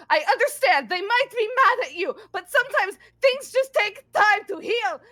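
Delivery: high, loud tearful yelling; desperate plea. Acted. Grendel pleading with Emrys to come home, high desperate shouting. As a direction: beg him through anger and grief. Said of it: "And she goes".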